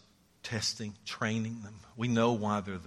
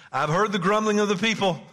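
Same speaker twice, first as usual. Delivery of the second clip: God's words, higher and louder; booming divine voice; 9 semitones higher, 7 dB louder than usual. Acted. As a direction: proclaim it as God speaking. Voicing God from the verse.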